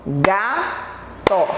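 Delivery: "Gato" split into ga, to with a pause; syllable by syllable. Shown as a demonstration. Teacher showing two mouth openings, "Gato" split syllable by syllable. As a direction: say "Gato" slowly in two separate pieces. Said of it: "eu abro duas vezes".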